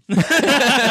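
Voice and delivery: high laugh. Real, not acted. Laughing after a joke.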